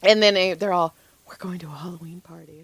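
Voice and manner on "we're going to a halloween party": fast, hushed mock whisper; whispered retelling. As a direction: imitate them in a quick whisper. Retells what the others said.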